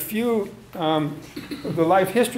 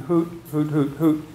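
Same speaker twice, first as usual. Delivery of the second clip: owl hoots. Retold or imitated. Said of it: "the basic four-note hoot".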